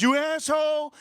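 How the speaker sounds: high mock yell of outrage; retold scream. Woman insulting the other driver, high mock scream. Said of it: "she started screaming".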